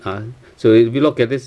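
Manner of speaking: ordinary delivery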